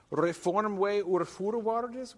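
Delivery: high, slow declaimed phrase; Middle English quotation. Acted. Lecturer quoting the Green Knight's first speech, declaimed. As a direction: declaim the old words slowly and high.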